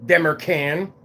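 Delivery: ordinary talk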